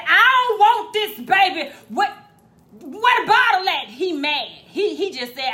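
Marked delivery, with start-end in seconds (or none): 0.0-3.9 put-on mad kid complaint. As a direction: complain loudly like a fed-up kid. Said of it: "he said".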